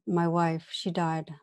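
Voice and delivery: normal talking voice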